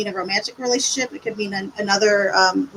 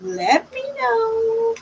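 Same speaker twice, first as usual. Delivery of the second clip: singsong; 10 semitones higher, much slower than usual. Pretend. Drawn-out singsong sign-off.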